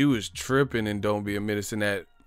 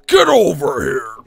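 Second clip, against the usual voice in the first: loud game-character shout; Scorpion yell; 4 semitones higher, 13 dB louder than usual. Retold or imitated. Imitating Mortal Kombat's Scorpion, a loud shout. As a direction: bellow it like Scorpion. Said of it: "like Scorpion".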